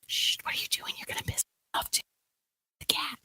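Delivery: urgent hushing whisper; nervous whisper. Acted. Git hushing his reckless cagemate, nervously whispered. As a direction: shush them in a worried, voiceless whisper. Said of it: "nervously like".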